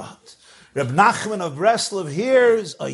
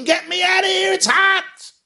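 high, loud panicked shout; screaming in pain; 5 dB louder than usual. Acted. Burning Chassid begs to be pulled out, high panicked shout. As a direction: yell to be pulled from the heat. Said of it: "he sees this fellow screaming".

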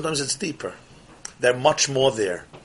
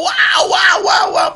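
high, loud cry of wonder, much higher and louder; awed cry; 6 dB louder, slower than usual. Retold or imitated. Teacher voicing a soul crying out in wonder, awed shout. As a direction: cry out in awestruck amazement.

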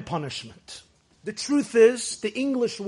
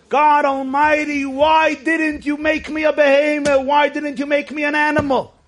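crying plea; 7 semitones higher, 5 dB louder than usual. Retold or imitated. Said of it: "and the boy starts crying".

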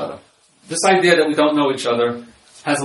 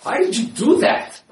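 high, slow, accusing demand for a reason; accusing why; 11 semitones higher, slower than usual. Shown as a demonstration. Husband confronting his wife in their room, accusing. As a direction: ask it like a charge she must answer. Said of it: "I could ask her two ways".

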